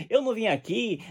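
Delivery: lightly acted preacher jab; mock preacher. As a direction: quote the vengeful preacher with a bit of edge. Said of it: "Digamos que alguém tá ensinando isso".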